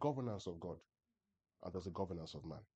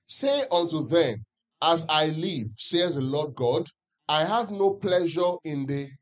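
slow, louder unison reading, every word spaced; louder group scripture reading; 6 semitones higher, 13 dB louder, slower than usual. Acted. Preacher leading the church in reading Ezekiel aloud, slow and louder. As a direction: read it out together slowly, in unison. Congregation reads together.

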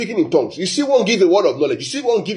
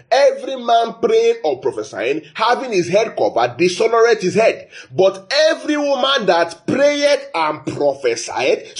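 raised, slowed reading of the verse; scripture reading; 5 semitones higher, slower than usual. Acted. Preacher reading Corinthians to the church, lifted slow voice. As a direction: read the verse out to the congregation.

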